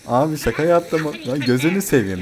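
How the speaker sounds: mock pleading. Pleading with a game enemy.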